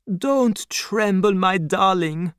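calm her trembling gently; comforting old doctor. Acted. Doctor soothes his frightened daughter Lucy, tender.

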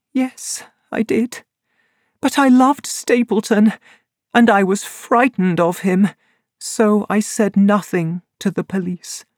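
high, guilty woman's voice; high voice of a frightened woman confessing; Laura Lyons ashamed. A narrator's reading. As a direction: admit it with shame.

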